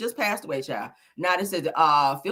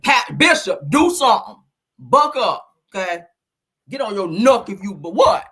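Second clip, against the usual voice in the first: louder imitating the angry bishop; voicing the look; 8 dB louder than usual. Host voicing what the bishop's look meant, louder. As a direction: bark out the look's challenge. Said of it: "that's what that meant".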